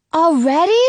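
high, loud shocked "Already?"; surprised boy. Acted. Jonah reacting to losing the wishes, high and shocked. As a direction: jump up in pitch, dismayed. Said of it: "Jonah says".

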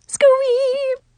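put-on high drawn-out girlish squee; giddy squeal. A narrator's reading. Girl gushing over her near kiss, giddy high squeal. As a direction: squeal it in swooning delight.

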